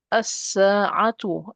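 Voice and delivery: very slow as-sa'atu with doubled S; lam dropped. Shown as a demonstration. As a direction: skip the L, hold the S in as-sa'atu. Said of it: "I don't say the lam".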